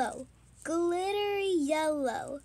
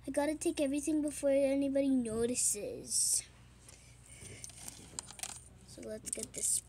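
whispering; 5 dB quieter, much faster than usual. Acted. Stealing before anybody notices.

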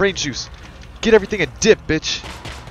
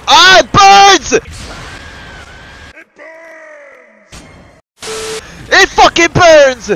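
high, loud, drawn-out scream of pain; 13 semitones higher, 6 dB louder, slower than usual. Real, not acted. Player screaming as his character burns, loud pained howl. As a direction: howl it like you are on fire. Character set on fire in game.

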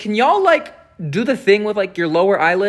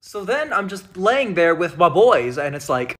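normal talking voice

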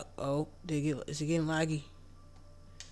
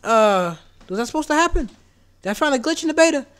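much higher and louder amazed cries; high, loud, rapid astonishment; loud disbelief; 10 semitones higher, 14 dB louder than usual. Real, not acted. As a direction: keep shouting in stunned excitement. Shocked at exploding goats.

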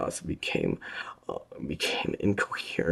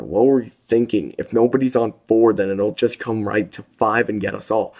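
loud, higher rebuke; angry scolding; 8 semitones higher, 11 dB louder than usual. A narrator's reading. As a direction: tell them off hard. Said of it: "He said out loud".

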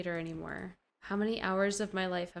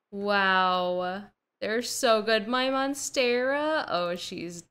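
ordinary delivery

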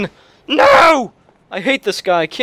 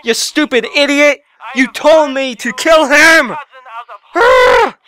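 higher, louder, slowed rant; angry; 4 semitones higher, 3 dB louder than usual. Real, not acted. Gamer ranting at the caller who told him to kill. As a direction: spit it at the traitor.

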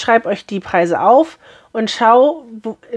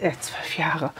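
quiet, dropped double take; startled self-correction; 6 semitones lower, 12 dB quieter than usual. Real, not acted. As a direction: catch your own mistake under your breath. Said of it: "Nee, zwölf Monate". (leise, verdutzte Selbstkorrektur)

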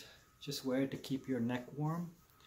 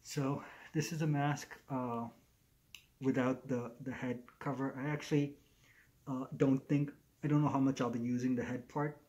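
muffled behind fabric mask; through a face mask. Real, not acted. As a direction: mouth covered by cloth.

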